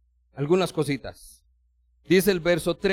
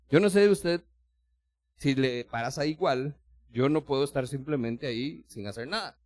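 normal talking voice

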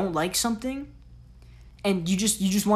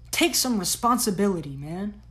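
ordinary delivery

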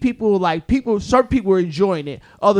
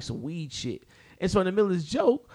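ordinary talk, nothing marked

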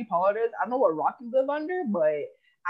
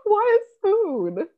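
much higher and very slow, drawn out in disbelief; baffled why; 12 semitones higher, 4 dB louder, much slower than usual. Real, not acted. Friend asks about an odd nineties saying, drawn-out baffled question. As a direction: stretch it out, truly puzzled.

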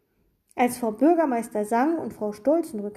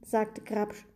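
usual speaking voice

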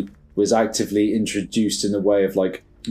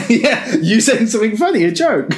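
laughing; 13 semitones higher, 5 dB louder than usual. Real, not acted. Laughing at friend's silly claim.

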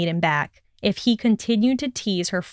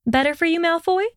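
taunting; 9 semitones higher, faster than usual. Acted. Taunting him sweetly.

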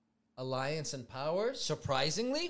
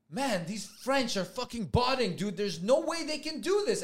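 higher-pitched, mildly incredulous rant; indignant streamer; 6 semitones higher than usual. Retold or imitated. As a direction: imitate a streamer complaining to his audience. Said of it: "he was talking on his live stream".

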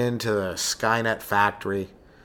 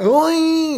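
very high, loud "oy"; long high-pitched cry of pain, mimicked; imitated pain yelp; 6 dB louder than usual. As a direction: imitate a hurt kid's yelp. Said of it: "But no, this guy".

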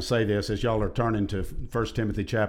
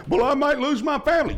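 mocking whiner; 13 semitones higher, 3 dB louder than usual. Mocking a fearful objection.

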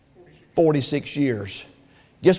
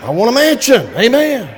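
loud; 5 semitones higher, 5 dB louder than usual. Acted. Preacher repeats louder.

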